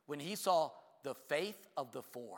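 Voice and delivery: slow reveal leaning on "four"; slow, weighted reveal. Shown as a demonstration. Preacher revealing the point to the congregation, slowly and with weight. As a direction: land "of the four" like a punchline. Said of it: "Wait, wait, wait".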